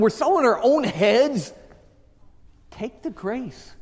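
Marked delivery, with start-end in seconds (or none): 2.7-3.7 slow, hushed urging; soft appeal. Real, not acted. Preacher to wound-up congregation, soft appeal.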